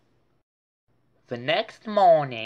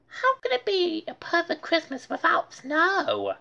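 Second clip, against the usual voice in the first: put-on much higher boy's voice for Arthur; Arthur's voice. A narrator's reading. Narrator voicing Arthur asking about snow. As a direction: voice Arthur wistful and high. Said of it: "said Arthur".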